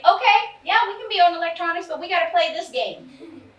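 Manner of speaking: high, loud, bright offer to play; cheerful mom coaxing. Retold or imitated. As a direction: mom tempting teen into a game, cheery. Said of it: "I started saying".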